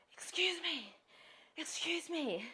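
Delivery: high, whispered call from a strange man; a whispered "excuse me" from a creepy stranger; stranger's whisper. Retold or imitated. Stranger calls from behind car.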